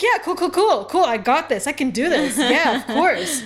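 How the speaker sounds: loud, high, overconfident; bluffing the host, loud and cocky; cocky bravado. Retold or imitated. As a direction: fake total confidence. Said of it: "I'm like".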